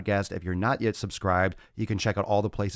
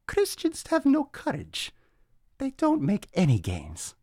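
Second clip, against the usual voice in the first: mock voice; 13 semitones higher, slower than usual. Mocking the commenter's claim.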